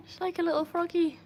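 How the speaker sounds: cutesy. Real, not acted. Owner gushes that her dog jumps like a froggy. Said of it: "so cute".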